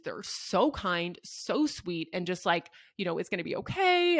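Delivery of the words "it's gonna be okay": much higher, louder, coaxing it's gonna be okay; high, gentle reassurance to a crying parent; soothing reassurance. Retold or imitated. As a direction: comfort a worried mom in a lifted, sing-song voice. Nurses reassuring a crying mother.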